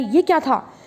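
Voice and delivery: ordinary talk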